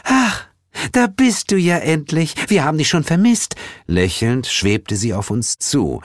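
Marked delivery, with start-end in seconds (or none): 0.0-3.5 high, gushing welcome; sweetly excited hostess; delighted spring goddess. A narrator's reading. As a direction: greet long-missed guests in a sugary high voice.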